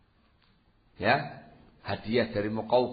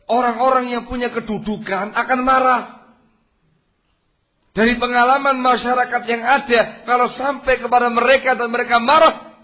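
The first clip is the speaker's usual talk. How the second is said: higher and louder, warning the crowd; fiery preaching; 10 semitones higher, 10 dB louder than usual. Real, not acted. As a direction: raise the voice to warn them. Preaching at full force. (ceramah berapi-api, lebih tinggi dan keras)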